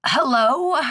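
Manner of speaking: put-on high, quavering old crone. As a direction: call out like a frail old hag. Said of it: "came the old crone voice".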